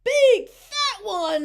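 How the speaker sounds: loud, high, slow shout; big drawn-out yell of a little boy; little boy's shout. A narrator's reading. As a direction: shout it out, stretching the words. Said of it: "shouted a little boy".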